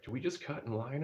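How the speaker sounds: a hint of an anxious child asking; imitating worried kids. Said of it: "all the kids are like".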